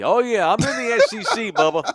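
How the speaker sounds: mocking Southern football fan. Mocking drunk redneck fan.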